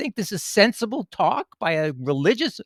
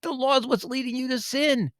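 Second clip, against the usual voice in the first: normal talking voice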